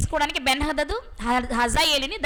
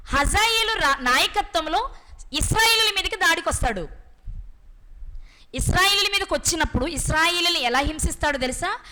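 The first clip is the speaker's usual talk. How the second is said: usual speaking voice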